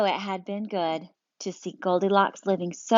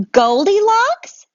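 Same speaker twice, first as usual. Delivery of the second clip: much higher, louder and slow; a bear amazed to see her; astonished drawn-out question; surprised bear; 13 semitones higher, 8 dB louder, slower than usual. Acted. Bear recognizing Goldilocks.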